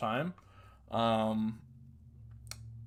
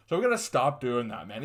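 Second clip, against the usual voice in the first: ordinary talk, nothing marked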